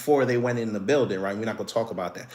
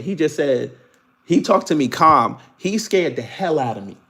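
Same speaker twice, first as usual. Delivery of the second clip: ordinary talk, nothing marked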